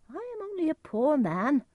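high put-on humble stranger; disguised stranger's voice. A narrator's reading. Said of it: "Edgar used a different voice".

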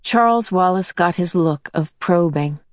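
ordinary delivery

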